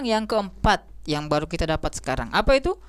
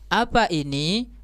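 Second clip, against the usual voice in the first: "apa ini" slow, lifting at the end; question intonation; slower than usual. Shown as a demonstration. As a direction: ask "apa ini" with a drawn-out rise on "ini". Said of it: "intonasinya juga kita harus sesuaikan". (intonasi bertanya, "ini" naik)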